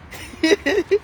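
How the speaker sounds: high-pitched giggle. Real, not acted. Rire aigu. (petit rire aigu)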